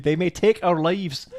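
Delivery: drawn-out bad Scottish film quote; awful Scottish accent. Player quoting a film line stuck in their head, bad Scottish accent. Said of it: "in his awful Scottish accent".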